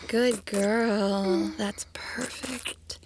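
slow hushed praise; whispering. Acted. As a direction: breathe it out slowly.

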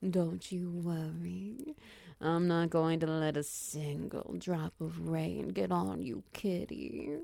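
usual speaking voice

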